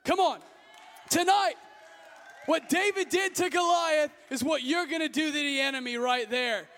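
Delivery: loud, high preacher's climax, shouting to the crowd; rally the youth like a revival preacher; preaching at full force. Acted.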